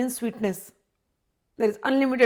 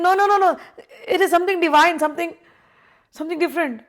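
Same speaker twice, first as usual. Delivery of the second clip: eager protest; 7 semitones higher than usual. Retold or imitated. Devotee eagerly defending love of Vrindavan, excited. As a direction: gush about something divine. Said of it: "You're like".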